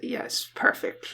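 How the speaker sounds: soft, slow, let down; quiet disappointed reply. Retold or imitated. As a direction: say it softly and sadly. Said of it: "He's like".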